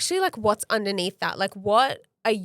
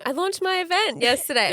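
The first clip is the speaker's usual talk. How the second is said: higher-pitched retold news to a partner; retold announcement; 8 semitones higher than usual. Telling her partner about her launched event, mock-excited announcement. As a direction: re-enact announcing it, pitch lifted.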